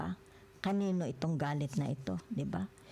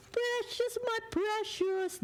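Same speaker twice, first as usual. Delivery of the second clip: very high, slow, strange creature voice croaking for the ring; ring-hungry creature; slower than usual. Retold or imitated. Tarot reader imitating the ring-craving creature, very high and slow. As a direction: stretch out my precious like a greedy monster. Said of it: "Pinagnanasaan nung parang impacto".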